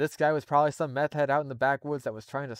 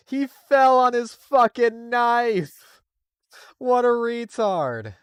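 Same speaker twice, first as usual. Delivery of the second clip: much higher, louder, very slow disbelieving yell; incredulous outburst; 11 semitones higher, 10 dB louder, much slower than usual. Real, not acted. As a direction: shout it out in amused disbelief. Reader reacts to absurd death.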